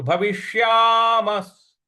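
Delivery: भविष्यामस् ending in a clear स sound; slow भविष्यामस् with a final s; visarga said as s. Shown as a demonstration. Teacher slowly models भविष्यामस् with the visarga as s. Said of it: "उसका उच्चारण हमें स ले आना है".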